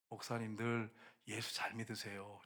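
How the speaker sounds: slow, low, very soft voice; bedridden elderly pastor. Retold or imitated. Old pastor's last word to young pastors, weak and slow. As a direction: speak feebly, as if lying on a sickbed. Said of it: "이제 이렇게 누워 계시는데".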